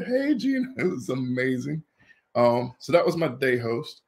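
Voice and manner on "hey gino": high-pitched hail, not shouted; imitated calling out. Another beachgoer greets the star player, imitated high call.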